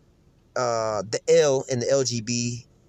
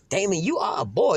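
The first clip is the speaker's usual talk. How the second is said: higher mock-incredulous voice; Cat Williams quote; 6 semitones higher than usual. Caller quoting Next Friday line, higher and mocking. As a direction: throw the line like a comedy quote. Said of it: "pulling one of those Cat Williams on next Friday".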